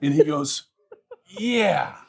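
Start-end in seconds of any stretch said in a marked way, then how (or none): 1.4-2.0 "yeah" breaking into a laugh, much higher. Retold or imitated. Teller delivering the punchline to viewers, laughing. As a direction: let the laugh crack through the yeah.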